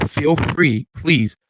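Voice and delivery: ordinary delivery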